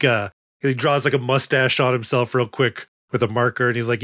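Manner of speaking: usual speaking voice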